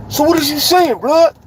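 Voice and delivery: high-pitched, pressing challenge; confrontational challenge. Retold or imitated. Acted challenge before the fight.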